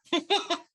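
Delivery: sudden high laugh; high burst of laughter. Real, not acted. Laughing at his own Johnny Bravo line.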